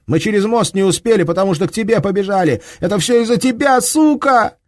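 high, loud, fast panicked blame; hysterical. Acted. Frightened Duska blames the German, hysterical shriek. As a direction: lash out in terror.

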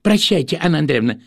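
lightly voiced eager townsman; excited gossip. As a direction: chatter high and quick. Bobchinsky voiced.

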